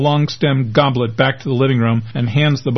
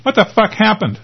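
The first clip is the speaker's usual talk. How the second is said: angry demand; 10 semitones higher than usual. A narrator's reading. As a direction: bark it down the phone, furious. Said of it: "the angry voice from the cell phone demands".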